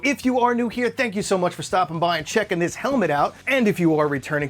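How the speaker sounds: ordinary talk, nothing marked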